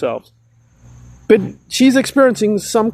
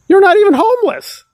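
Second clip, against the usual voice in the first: higher and louder in disbelief; exasperated outburst; 11 semitones higher, 4 dB louder than usual. Real, not acted. Scolding Nora directly, a raised exasperated outburst. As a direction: throw up your hands at her.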